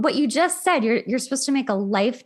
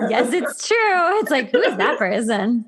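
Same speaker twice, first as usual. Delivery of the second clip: laughing agreement, high; 3 semitones higher than usual. Real, not acted. Host agreeing with guest about fake profiles, laughing. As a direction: giggle through it.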